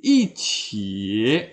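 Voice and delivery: "i ci" slowly, falling "i", low dipping "ci"; fourth tone before third. Shown as a demonstration. Teacher showing the change, "i ci" slowly with falling "i". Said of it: "i akan menjadi i".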